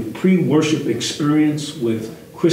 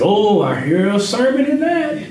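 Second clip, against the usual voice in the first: the Holy Ghost speaking; 8 semitones higher than usual. Retold or imitated. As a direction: voice an inner holy insight, lifted up. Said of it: "the Holy Ghost came over me and said".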